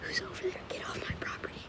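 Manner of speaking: whispering the owner's words; hushed retelling of a threat; whispered quote. Retold or imitated. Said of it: "He's saying".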